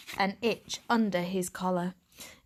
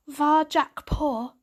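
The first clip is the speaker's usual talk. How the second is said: slow, drawn-out, tasting the name; mocking repeat; 7 semitones higher, 4 dB louder, slower than usual. A narrator's reading. Stray cat mulling the strange name, slow and wry. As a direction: roll the name slowly as if odd.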